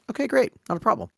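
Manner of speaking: high, easygoing reassurance; breezy acceptance. Acted. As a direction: wave it off lightly and high. Breezy acceptance of a friend's news.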